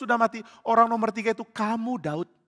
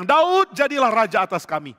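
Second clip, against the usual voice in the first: loud, raised call to a leader; crowd's acclamation; 3 semitones higher, 5 dB louder than usual. Acted. Israelites hailing David as king, loud acclamation. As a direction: call out as a whole crowd would. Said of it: "langsung mereka bilang".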